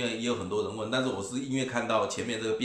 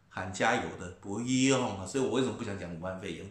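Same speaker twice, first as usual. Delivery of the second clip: normal talking voice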